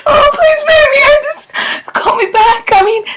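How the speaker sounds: high, frantic begging; panicked pleading. Pretend. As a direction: beg him to call back. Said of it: "I'm gonna do a prank voice message".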